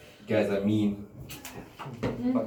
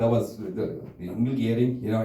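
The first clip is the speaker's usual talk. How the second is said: normal talking voice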